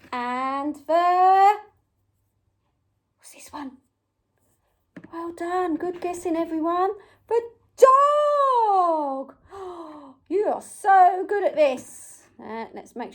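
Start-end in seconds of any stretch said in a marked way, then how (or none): 3.2-3.8 very soft, whispered question; secretive whisper. Pretend. Presenter asking children about the hidden toy, whispering. 7.3-9.4 excited reveal. Pretend. Presenter revealing the toy to children, excited drawn-out call.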